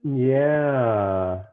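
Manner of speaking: usual speaking voice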